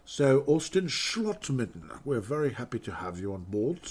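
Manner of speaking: slow, drawn-out British announcer; a hint of a stately British voice; British accent. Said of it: "you can just hear a British accent".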